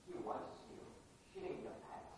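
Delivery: whispered threat; menacing whisper. Acted. Student warning the counselor, a menacing whisper. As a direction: hiss it low and close.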